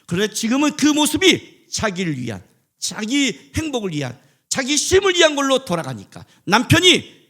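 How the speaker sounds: loud, high, impassioned sermon voice; a pastor pleading at full force; fervent preaching. Real, not acted. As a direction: preach it hard, rising to a cry.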